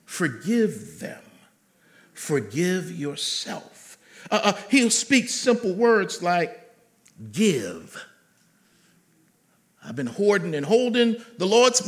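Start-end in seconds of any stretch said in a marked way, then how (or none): 0.0-4.0 very slow, low, weighted words; slow and deliberate. Shown as a demonstration. Preacher drives home forgiveness slowly. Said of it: "slow emphatic delivery of the word". 7.3-8.0 low, drawn-out forgive. Acted. God's one-word command, low.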